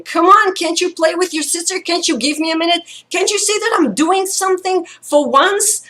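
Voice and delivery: high-pitched, fed-up parent scolding; pleading, pent-up anger at a kid; exasperated outburst. Retold or imitated. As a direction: re-enact snapping at your child, pitch way up. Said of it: "all the rage that I had".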